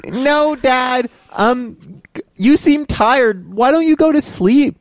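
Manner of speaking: put-on high, slow sweet-talking kid. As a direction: coax dad to bed like a child.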